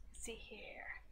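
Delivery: soft whisper to herself. Real, not acted. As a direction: breathe it under your breath. Reading to herself.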